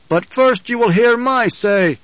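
high, slow, projected insistence; commanding. A narrator's reading. Paul demanding the throng hear him first, commanding. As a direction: command the crowd to listen. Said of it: "subtle intonations as he used the powers".

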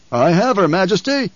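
loud eager baron shout. A narrator's reading. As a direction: shout it to the emperor. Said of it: "the Baron shouted".

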